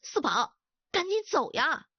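sudden sharp order, rising high at the end; stern, sharp command. A narrator's reading. Sifeng hurries Sibao away from the visitor, sharply. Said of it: "四凤突然厉声地说道".

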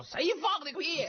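angry yell. Acted. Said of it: "就骂道".

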